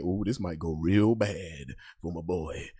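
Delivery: low, soft, slow dread; worried. Retold or imitated. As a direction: murmur the bad feeling. Fearing for his friend.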